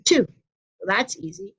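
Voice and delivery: normal talking voice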